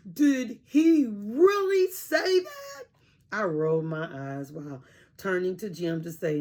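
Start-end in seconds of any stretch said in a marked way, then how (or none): 0.1-2.8 can't believe the good news; excited disbelief. A narrator's reading. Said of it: "He smiled and said".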